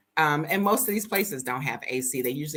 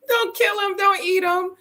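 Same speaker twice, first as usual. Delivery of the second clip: mock crying plea; 14 semitones higher, 5 dB louder than usual. Pleading for chickens.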